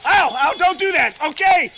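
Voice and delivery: loud cries of pain; pained cry. Real, not acted. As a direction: cry out while hurting. Being tased.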